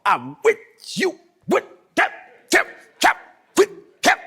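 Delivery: high playful "whip, tap" noises; mock whip sounds. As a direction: act out the lashes with a grin. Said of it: "he was using words with every team, time he swung".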